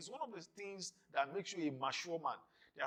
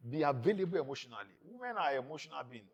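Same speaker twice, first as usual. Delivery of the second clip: ordinary talk, nothing marked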